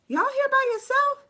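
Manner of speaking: high, fussing mom voice; motherly concern. Retold or imitated. Worried mom asking girls if they're alone, high fussing voice. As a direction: ask like a worried mother checking in. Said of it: "getting mom on JoJo and Kendall".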